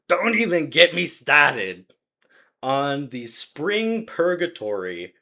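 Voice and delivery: slow, loud segment-title delivery; dramatic announcement. Acted. Opening the podcast rant segment, slow and loud. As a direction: drag it out like a show catchphrase.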